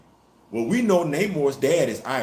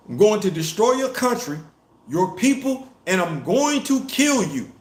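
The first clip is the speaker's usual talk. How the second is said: threatening quote; 7 semitones higher than usual. Retold or imitated. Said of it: "told Ramunda".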